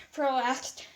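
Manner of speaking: ordinary delivery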